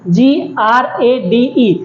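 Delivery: letters spelled one by one, slowly; slow spelling out. Shown as a demonstration. Teacher showing pupils how to spell a word, slowly. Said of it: "आपको ऐसे ही पढ़ना है".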